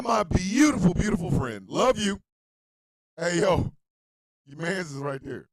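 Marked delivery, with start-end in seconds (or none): none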